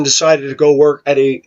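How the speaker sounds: ordinary delivery, nothing marked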